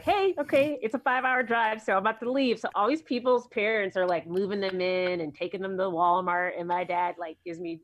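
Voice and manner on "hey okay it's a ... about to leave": mock cheery dad voice, pitched higher and louder; breezy dad. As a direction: play the carefree dad heading off. Dad casually leaving her at college.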